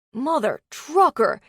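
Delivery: high, loud, slow yelp of pain; shouted curse. Acted. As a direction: cry out after slamming into the door frame. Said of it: "he shouted".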